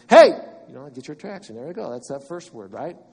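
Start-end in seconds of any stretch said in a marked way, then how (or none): 0.1-0.3 short call for attention; ordinary shout. Shown as a demonstration. Preacher demonstrating a plain attention-getting shout. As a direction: call out to get noticed. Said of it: "like an ordinary shout just to get the attraction".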